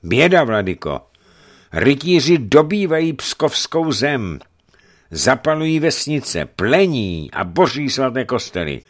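high, loud lament; tearful alarm. A narrator's reading. Envoy from Pskov reporting the knights' attack, tearful. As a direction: cry out the dire news in distress. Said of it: "V jeho hlase se chvěly slzy".

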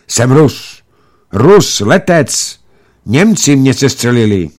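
high, loud, emphatic protest; desperate insistence. A narrator's reading. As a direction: insist loudly that you are a friend. Airman desperately insists he is Russian.

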